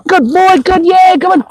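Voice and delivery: high-pitched excited praise; real high, eager cheering to a dog. Real, not acted. Trainer cheering dog on in play.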